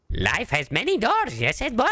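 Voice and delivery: Rolf voice. Retold or imitated. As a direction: do a goofy farm-boy Rolf. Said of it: "Rolf impression opening".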